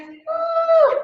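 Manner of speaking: very high held woo; sharp, high whoop of effort; strained woo. Real, not acted. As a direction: squeal out a woo on the squeeze. Squeezing deeper into the stretch.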